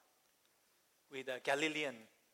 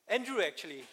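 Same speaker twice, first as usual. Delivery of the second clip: ordinary talk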